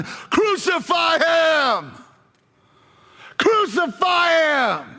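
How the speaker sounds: very high, drawn-out scream; strained, high-pitched, slow shout; mob shouting. Acted. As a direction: yell it like a crowd demanding death. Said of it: "they kept shouting".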